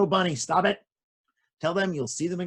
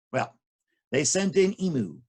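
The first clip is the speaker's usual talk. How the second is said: plain reading voice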